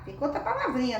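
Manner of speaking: ordinary talk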